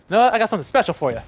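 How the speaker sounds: high, fast taunting sing-song; teasing. Acted. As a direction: taunt the prey playfully. Taunting an enemy before attacking.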